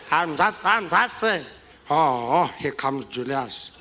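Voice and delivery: put-on tipsy old statesman, halting and repetitive; Madiba. Drunk host joking with Bill Clinton, slurred impression. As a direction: mimic a drunk elderly leader hailing guests. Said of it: "get totally wasted on his birthday".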